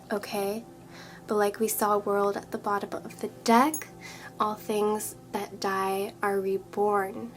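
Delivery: ordinary talk, nothing marked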